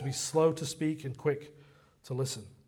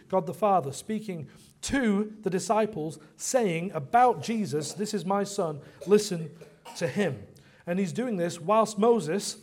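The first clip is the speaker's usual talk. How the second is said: raised, punched preaching with weighty key words; emphatic preaching; 4 semitones higher, 4 dB louder than usual. Real, not acted. Preacher telling congregation God speaks to the disciples, emphatic preaching. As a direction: drive each question and answer home.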